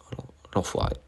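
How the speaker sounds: whispered mock outrage; exasperated whisper. Real, not acted. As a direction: breathe out the disbelief. Beaten by the strong opponent.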